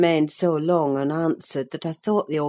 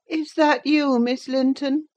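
put-on higher, querulous invalid boy; sickly boy, surprised; 10 semitones higher than usual. A narrator's reading. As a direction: lift your head and ask, weakly surprised. Linton surprised by visitors.

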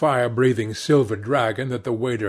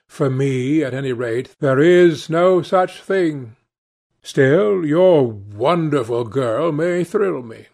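lightly voiced aristocrat, emphatic and higher; assured Lord Henry; 4 semitones higher, 5 dB louder than usual. A narrator's reading. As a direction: muse aloud, then lightly take command. Lord Henry muses, then takes charge.

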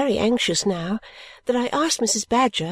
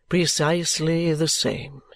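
put-on much lower, slow and earnest elderly husband; deferential Mr Badger; 5 semitones lower, slower than usual. Mr Badger explaining to Miss Clare, much lower and deferential. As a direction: defer fully to his wife's judgement.

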